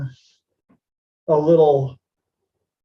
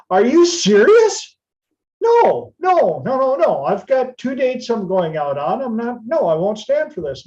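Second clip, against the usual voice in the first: outraged disbelief; 8 semitones higher than usual. Retold or imitated. Refusing the stranger who wants to crash in outraged disbelief. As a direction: squeal no, no, no in disbelief. Outraged at his request to stay.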